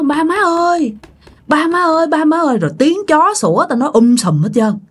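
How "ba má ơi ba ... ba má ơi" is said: high, repeated call from outside; high insistent calling; daughter calling out. Acted. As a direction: call and call for your parents. Daughter's calls heard through the door.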